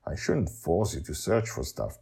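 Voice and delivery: usual speaking voice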